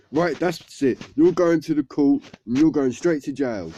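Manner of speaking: mock-outraged officer passing judgement; acted stern outburst. Policeman acted sending boy to jail.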